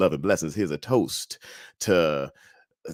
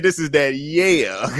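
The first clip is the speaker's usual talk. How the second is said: retold brag; 3 semitones higher, 3 dB louder, slower than usual. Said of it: "I did say this".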